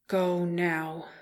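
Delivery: gritted teeth, held back; through gritted teeth. A narrator's reading. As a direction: force it through clenched teeth. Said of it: "he said through gritted teeth".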